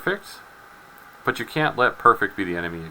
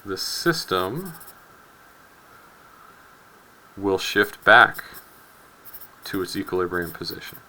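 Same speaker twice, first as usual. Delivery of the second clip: reading a principle out slowly and deliberately, no accent; slow, deliberate textbook recitation; slow formal reading; slower than usual. Shown as a demonstration. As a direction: spell out the law slowly, like a solemn recitation. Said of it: "while I read this".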